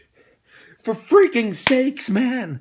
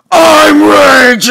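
long, slow, high furious yell; raging shout; 6 semitones higher, 16 dB louder, much slower than usual. Acted. Host opens his rage segment with a slow furious yell. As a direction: scream the rage at full force. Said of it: "This is my rage segment".

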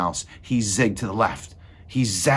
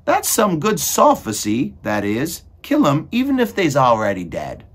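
high, loud approval; admiring character voice; 7 semitones higher, 7 dB louder than usual. A narrator's reading. Meg approves of Cook's philosophy, high and loud. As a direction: agree loudly in a high voice. Meg loudly agrees with Cook.

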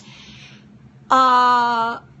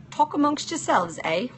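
playful put-on British accent with a higher lilt; hammed-up British accent, higher; mock British accent; 6 semitones higher than usual. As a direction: toss it off in a jokey British accent. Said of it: "enjoy my British accent".

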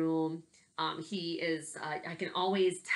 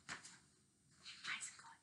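whisper; 18 dB quieter than usual. Acted. Whispered repeat.

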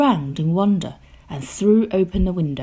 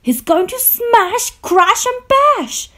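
high, loud excited squeal; crying out; 6 dB louder than usual. A narrator's reading. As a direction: cry it out, drawing out the last words. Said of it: "cried Gloom and Doom".

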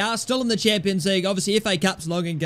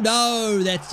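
ordinary talk

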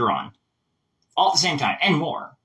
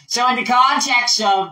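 higher American politician voice; in-character speech; 6 semitones higher, 4 dB louder than usual. Shown as a demonstration. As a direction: lift it into the candidate's voice. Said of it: "I'm gonna speak later".

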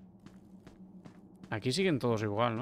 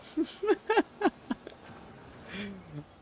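high-pitched laughing fit; high laughter; 9 semitones higher than usual. Real, not acted. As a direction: laugh off the fright, much higher. Laughing after the scare.

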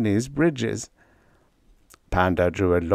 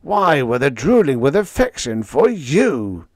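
higher, louder outraged howl; 5 semitones higher, 5 dB louder than usual. A narrator's reading. Leo howling at Freddie, still outraged. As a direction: keep howling in disbelief. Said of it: "Leo howled".

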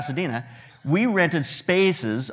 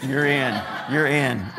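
laughing through the words; slower than usual. Real, not acted. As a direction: give in while chuckling. Dad laughs while giving in.

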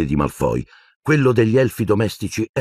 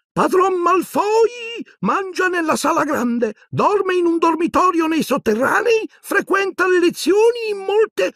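very high, slow, stiff house-elf voice; furious old elf. A narrator's reading. Creature reluctantly reporting to Harry, very high and slow. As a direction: report through suppressed fury.

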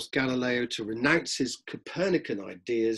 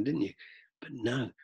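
ordinary talk, nothing marked

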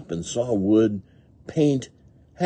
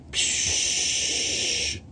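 long, voiceless hiss; shower noise. A narrator's reading. Rabbit washing off at the shower, long hissing spray. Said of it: "with the shower noise".

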